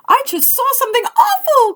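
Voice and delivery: a tiny mouse blurting out what it saw; frightened mouse. Acted. Mouse warns the animals, squeaky frightened voice. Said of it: "cried the mouse".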